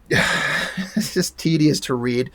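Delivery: slow, soft and worn down with a sigh; drained, quiet tedium; weary exasperation. Real, not acted. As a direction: sigh it out, bored and fed up. Weary of tedious exposition.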